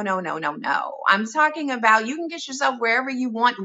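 ordinary delivery

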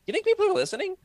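high, squeaky unsure question; doubtful question. Retold or imitated. Early podcasters wondering aloud, a high doubtful squeak. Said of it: "Freddie and I were like".

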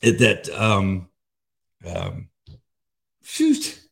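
fighting back tears while talking; halting, breaking off and laughing at yourself; choked up. Real, not acted. Speaker moved while sharing signs from God, choked up. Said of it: "I'm getting so emotional".

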